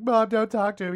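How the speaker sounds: put-on distressed warning; mock tearful plea. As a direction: plead like kids protecting their mom. Imitating crying children warning mom.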